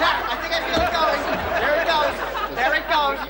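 straining while lifting. Acted. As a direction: hold the strain through the words. Straining under couch weight.

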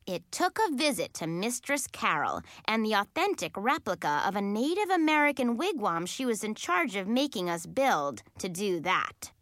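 plain reading voice

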